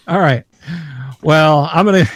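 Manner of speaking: loud, gruff dog voice. Retold or imitated. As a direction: voice the dog's reply loudly. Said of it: "He's like".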